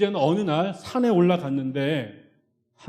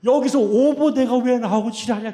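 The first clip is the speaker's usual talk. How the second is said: louder, higher, fed-up; exasperated outburst; 7 semitones higher, 9 dB louder than usual. Retold or imitated. Pastor venting to congregation, loud exasperated outburst. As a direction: blurt it out in disbelief.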